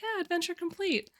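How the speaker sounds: light, high game pop-up announcement; small, high-pitched mock game message; quest pop-up voice. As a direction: announce it softly up high, like a little quest notification. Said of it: "little, like, quest pop up".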